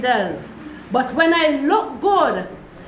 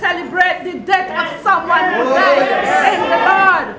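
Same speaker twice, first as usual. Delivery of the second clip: raised, higher, louder preacher voice; fervent preaching; 6 semitones higher, 4 dB louder than usual. Real, not acted. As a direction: proclaim it to the congregation. Preaches over congregation's responses.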